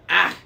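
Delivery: put-on loud high screech of an old hag. A narrator's reading. Old woman screeching at the kids. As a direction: shriek it out like a crone. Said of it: "the old woman screeched".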